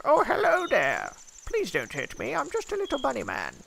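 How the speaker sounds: tiny frightened critter pleading; timid bunny voice. Retold or imitated. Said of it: "peeking over my shield like".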